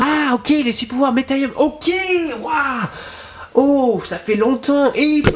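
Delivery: high, thrilled reaction; excited. Real, not acted. Viewer thrilled by the six powers, excited. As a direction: geek out over the six powers.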